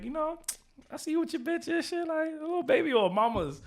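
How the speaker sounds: high, soft come-on in a put-on woman's voice; flirty shorty. Shorty sidling up to him at a [name] party, high and flirty. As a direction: pitch it well up and keep it soft, like a shorty making a pass. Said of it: "if a shorty came to me and she was like".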